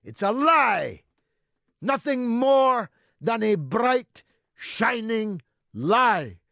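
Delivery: high, very loud rally oratory with pauses; fiery denunciation. Real, not acted. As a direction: shout the accusation out phrase by phrase. Shouted denunciation of a lie.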